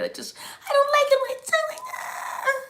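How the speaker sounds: very high squeaky complaint; mock voice student. Student disliking their own voice, hammed-up high squeal. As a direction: squeal it, then break into a voiceless ah. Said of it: "your little voice lessons".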